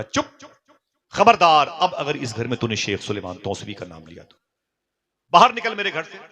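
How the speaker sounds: wife yelling at her husband about the pir; furious wife. Retold or imitated. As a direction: bark at him to get out.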